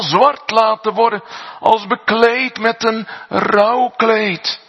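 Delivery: high, loud preaching, slowing on key lines; fiery preaching. Acted. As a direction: thunder God's power to the congregation. Preaches at full force.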